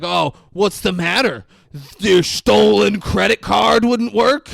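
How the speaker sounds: loud, high, drawn-out mock concern; mocking taunt. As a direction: rub it in, stretching the words out loudly. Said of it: "He's like".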